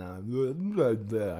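drawn-out, garbled mock slur; imitated slurred speech. Man re-enacting his stroke for listeners, mock slurred speech. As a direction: let the word smear out as if the tongue failed. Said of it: "I start slurring speech".

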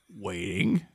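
fading mock wait. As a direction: let the word sink away. Repeated mock waiting, fading.

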